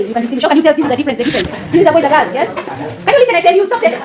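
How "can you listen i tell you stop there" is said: raised, higher-pitched teacher's command; calling to order. Real, not acted. Teacher halting students who interrupt, calling to order. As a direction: cut sharply across a noisy class.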